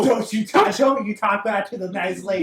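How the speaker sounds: scolding. Acted. Player scolds bust.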